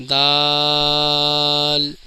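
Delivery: دال very slowly, long aa closing on l; correct pronunciation. Shown as a demonstration. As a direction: stretch دال and sound the final l. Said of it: "دال کا صحیح تلفظ ہے".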